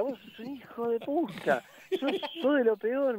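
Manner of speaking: high, slow mock outrage; compañero indignado. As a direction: curse the prankster in a high put-on voice. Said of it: "después me decía". (insulto agudo, indignado en broma)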